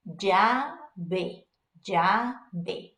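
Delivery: "llave" said JA-be, opening on a J sound; middle-ground double L. Shown as a demonstration. Teacher models the example word, middle-ground double L. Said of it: "middle of the ground".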